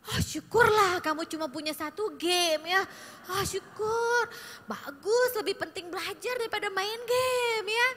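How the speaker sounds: preachy relieved aunt. Shown as a demonstration. Aunt lectures teen about study, preachy relief. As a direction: gush with relief, then lecture. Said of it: "Itu juga roadblocks".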